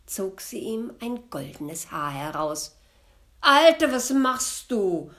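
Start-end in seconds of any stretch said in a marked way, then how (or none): 3.4-3.9 much louder, much higher furious shout; fed-up devil shouting at grandmother; devil's roar. Acted. Devil woken again, angrier. 3.9-5.1 loud, slow furious demand; angry devil. Acted. Devil woken again.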